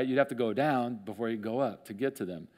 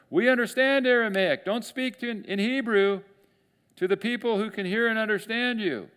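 calling out; 10 semitones higher, 4 dB louder than usual. Retold or imitated. Leaders calling up to envoy.

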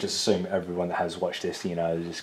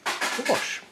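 whispered. Real, not acted. Whispered high.

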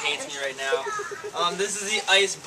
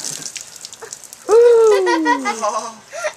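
shocked laughing shriek; 10 dB louder than usual. Real, not acted. Ice water just poured on.

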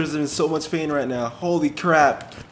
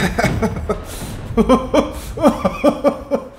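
long, high, wheezing laugh; breathless pained laugh; 7 semitones higher than usual. Real, not acted. Player recovering from a shock, helpless breathless laughter. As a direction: laugh helplessly after the jolt.

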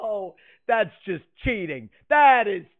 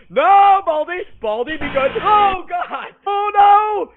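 scared horror game streamer; 8 semitones higher, 6 dB louder than usual. Real, not acted. Baldi chasing him.

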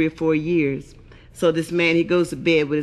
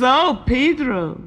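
slow, lilting mock Mexican accent; 4 semitones higher, slower than usual. Rosita explaining her spots to Pedro, Mexican accent. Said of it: "She says".

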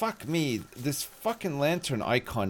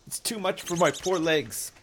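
higher, whiny mock agony over a half squat; mock strain; 6 semitones higher than usual. Streamer straining through a half squat for chat, hammed-up agony. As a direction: ham up the effort like it's torture. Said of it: "I can't handle it".

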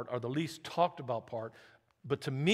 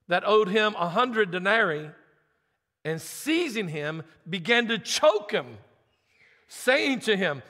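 louder, measured reading of the parable; scripture reading; 4 semitones higher, 6 dB louder than usual. Acted. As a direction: proclaim the text to the church. Pastor reads parable aloud.

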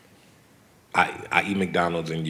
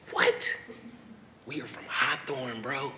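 hushed amazement; 6 semitones higher, 7 dB quieter than usual. Retold or imitated. Acting out his amazement on the jet.

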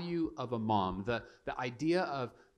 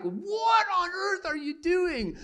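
mock outrage; 5 dB louder, slower than usual. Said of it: "It's like".

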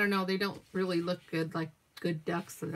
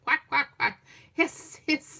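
higher, louder duck quacks into a breathy hiss; quacking ducks; 6 semitones higher, 4 dB louder than usual. Acted. Ducks warn off Angus in the story, higher louder quacks and hiss. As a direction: play the ducks turning on the dog.

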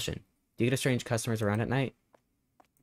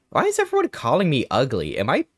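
offended whine; 6 semitones higher, 5 dB louder than usual. Acted. Voicing offended character.

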